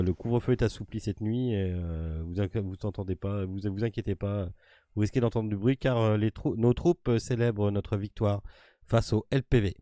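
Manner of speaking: loud, proud military captain; proud officer announcing. Acted. GM voices captain proclaiming victory.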